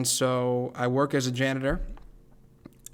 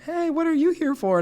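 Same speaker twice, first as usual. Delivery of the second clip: high bright mock voice of a festival guest; imitating a stranger; 4 dB louder than usual. Festival-goer asking him why he came, high mock voice. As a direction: pitch way up to play a chatty stranger. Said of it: "and they're like".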